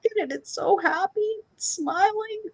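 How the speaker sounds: put-on very high childish voice; a kid pointing at her smiling food; squeaky tearful child; little girl pleading. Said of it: "child pleading over her food".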